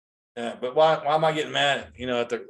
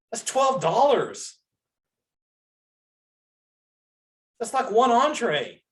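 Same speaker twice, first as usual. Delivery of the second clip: drawn-out, much higher, each word weighted; slow emphatic; 10 semitones higher, slower than usual. Real, not acted. Telling listeners what family sodas cost, slow and emphatic. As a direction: lean hard on the cost.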